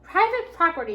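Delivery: yelled. A narrator's reading. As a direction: bark it like a grumpy owner. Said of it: "yelled Hank".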